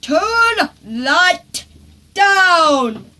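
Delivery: loud shouted order; Dad yelling at Henry to turn down the stereo. A narrator's reading. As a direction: shout over the music. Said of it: "Dad yelled".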